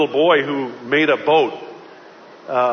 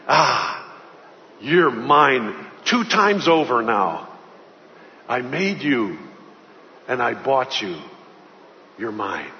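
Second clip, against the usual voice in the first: softer and slower, fading almost to nothing; tender hush; 5 semitones lower, 4 dB quieter, slower than usual. Acted. Boy walking out, murmuring lovingly to the boat he bought back. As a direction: murmur it lovingly to the toy in your hands.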